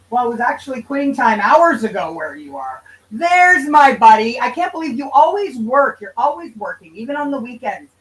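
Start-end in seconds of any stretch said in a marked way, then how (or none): 3.1-4.4 excited greeting. Real, not acted. Greeting a chat friend.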